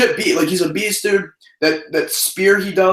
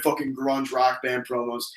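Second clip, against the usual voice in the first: normal talking voice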